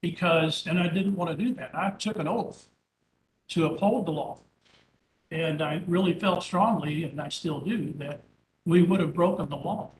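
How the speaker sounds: usual speaking voice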